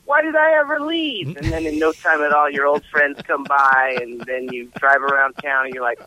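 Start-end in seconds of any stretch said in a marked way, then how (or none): 0.0-1.4 wistful high voice. Retold or imitated. Nostalgic quote going home.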